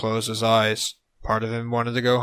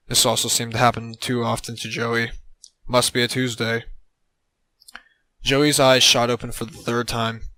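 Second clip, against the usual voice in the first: usual speaking voice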